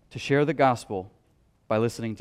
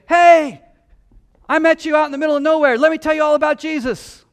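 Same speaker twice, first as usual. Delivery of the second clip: high, loud, fast mock pushy witness; pushy evangelist; 11 semitones higher, 10 dB louder, faster than usual. Preacher mimics a pushy evangelist, high, loud and fast. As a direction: barge in like an overeager preacher. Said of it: "go up and say".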